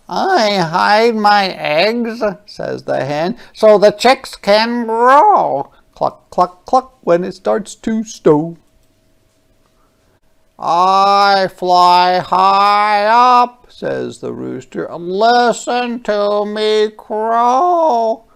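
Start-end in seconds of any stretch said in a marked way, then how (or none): 0.1-2.2 high, louder, slow hen voice; a careful mother hen; storybook hen protecting eggs. Acted. 3.5-5.7 a careful mother hen; storybook hen protecting eggs. Acted. 5.9-7.0 high clucking; clucking hen; hen clucks. Acted. 10.6-13.7 high, loud, very slow rooster; boastful rooster. Acted. Rooster speaks in story. 15.0-18.2 high, loud, very slow rooster; boastful rooster. Acted. Rooster speaks in story.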